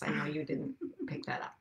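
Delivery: hushed comic aside; whispered aside. Real, not acted. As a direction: whisper it like a secret joke. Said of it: "whispered joke continues".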